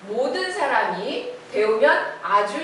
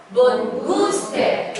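몬구스테 slower, weight on 구; stressed 몬구스테; 3 semitones higher, 4 dB louder, much slower than usual. Shown as a demonstration. Teacher models stress on a new word. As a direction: lean on 구 in 몬구스테. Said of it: "여기다 강세 넣어서".

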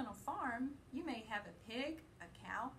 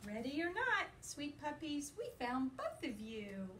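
lively, sing-song picture-book reading; reading to children; 5 semitones higher than usual. A narrator's reading. Teacher reading a puppy book to her class, playful and lively. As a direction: read it brightly to little listeners.

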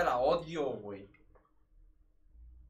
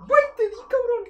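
triumphant shout; 13 dB louder than usual. Real, not acted. Landing a hit in game.

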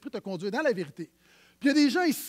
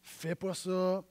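gentle warning; 4 semitones lower, 5 dB quieter, slower than usual. Pretend. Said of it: "Il me dit toujours".

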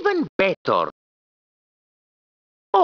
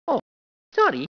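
ordinary talk, nothing marked

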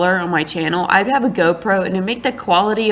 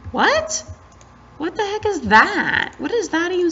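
high, incredulous protest; baffled indignation; 11 semitones higher than usual. Real, not acted. Baffled that emote is blocked.

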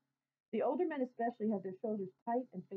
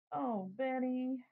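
slow, drawn-out annoyed sigh of a name; tired older brother, mildly fed up; older brother woken at night, annoyed; sleepy annoyance; 4 semitones higher, 4 dB louder, much slower than usual. A narrator's reading. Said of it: "I say in sleepy annoyance".

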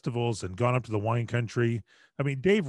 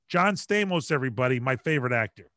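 normal talking voice

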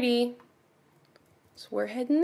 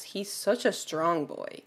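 ordinary delivery

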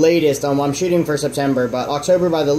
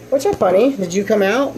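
talking to pet fish; 6 semitones higher than usual. Real, not acted.

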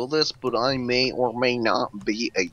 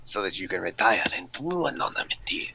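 whispering; 3 semitones lower, 5 dB quieter than usual. Acted. Whispered briefing.

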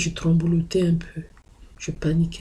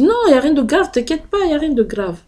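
much higher, louder "non" to calm her; urgent reassurance; 10 semitones higher, 5 dB louder than usual. Retold or imitated. Daughter insisting to her mother nothing is grave, high and loud.

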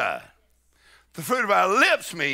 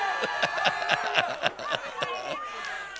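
high joyful laugh; 5 dB quieter than usual. Real, not acted. Laughing after praising God.

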